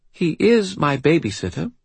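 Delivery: put-on high, grave little-girl voice, faintly British; serious child with a faint British accent. A narrator's reading. Seven-year-old archive correcting the wizard, serious faint British accent. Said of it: "serious and marked with a faint British accent".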